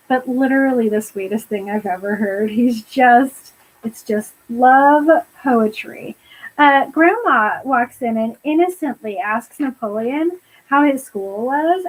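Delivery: ordinary talk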